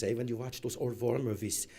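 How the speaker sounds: ordinary talk, nothing marked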